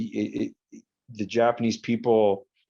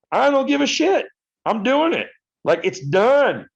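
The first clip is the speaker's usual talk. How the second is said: high, loud, cocky cheer ending in a yee-hoo; defiant cowboy whoop; 14 semitones higher, 5 dB louder than usual. Retold or imitated. To the angry waitress, cocky mock cowboy whoop. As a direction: brag like a cowboy riding off. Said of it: "I'm just like".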